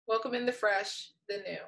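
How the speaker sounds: normal talking voice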